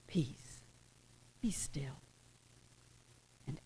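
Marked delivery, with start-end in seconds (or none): 0.1-2.0 hushed command. Retold or imitated. Jesus calming the sea, whispered command.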